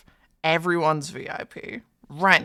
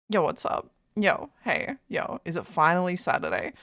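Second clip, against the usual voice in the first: ordinary talk, nothing marked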